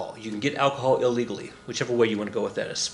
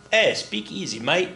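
higher-pitched mock Australian accent; 5 semitones higher than usual. Teacher imitating an Australian bootlegger, Australian accent. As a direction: put on an Aussie "mate" accent. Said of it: "don't make fun of my Australian accent".

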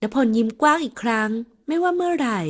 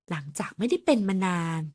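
usual speaking voice

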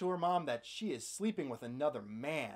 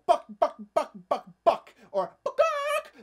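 loud buck buck clucks, the last very high; chicken clucking; 8 semitones higher, 7 dB louder, slower than usual. Shown as a demonstration. Correcting the movie, loud real chicken clucks. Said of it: "chickens don't make those noises".

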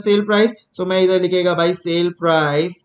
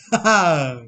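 short laugh. Real, not acted. Laughs after joke.

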